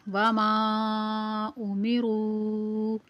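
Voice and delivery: वमा उमिरू with the ends of both words held very long; drawn-out vowels. Shown as a demonstration. Teacher showing learners the madd, वमा उमिरू drawn out long. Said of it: "इनको खींच कर पढ़ेंगे".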